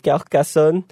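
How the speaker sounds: attempted French accent on Carcassonne. Shown as a demonstration. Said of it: "attempt to say it with the French accent".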